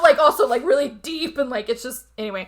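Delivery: normal talking voice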